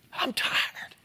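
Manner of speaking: a weary, breathless whisper; whispered exhausted I'm tired. Acted. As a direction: let it sink out on a whisper. Voicing worn-out woman, whispered.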